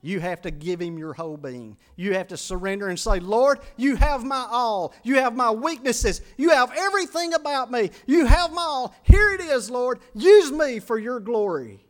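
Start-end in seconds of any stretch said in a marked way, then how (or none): 2.0-11.9 high, loud, building surrender cry; climactic preaching. Acted. Preacher urging the congregation to surrender, rising to a loud cry. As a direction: build to a shout of total surrender.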